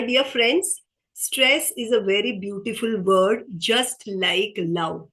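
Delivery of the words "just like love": slow, drawn-out just like love; slow emphasis. Shown as a demonstration. Trainer to workshop audience, slow and drawn out. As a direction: let just like love land slowly, word by word. Trainer repeats key phrase.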